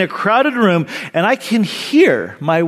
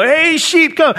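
big yelled call; 10 semitones higher than usual. Acted. Young pastor yells to sheep with all his gut, big voice. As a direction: holler it like a revival preacher. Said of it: "a big voice and, you know, just yelled out".

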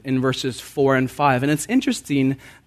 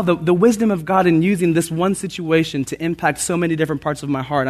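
usual speaking voice

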